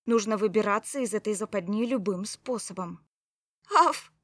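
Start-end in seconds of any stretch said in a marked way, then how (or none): 3.7-4.1 a high, loud, pitiful little yap; plaintive yap. A narrator's reading. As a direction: yelp like a trapped fox cub. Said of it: "жалобно тявкнула я". (жалобное тявканье)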